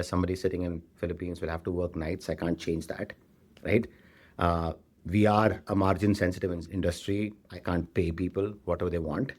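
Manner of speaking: usual speaking voice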